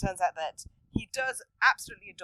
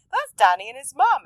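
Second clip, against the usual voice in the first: lightly voiced mum; 5 semitones higher, 10 dB louder than usual. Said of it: "his mum's like".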